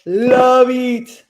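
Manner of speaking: loud, high, sustained burst of enthusiasm; emphatic gushing. Real, not acted. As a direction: belt it out with delight. Gushing over a favourite show.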